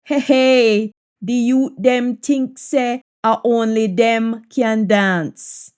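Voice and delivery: ordinary talk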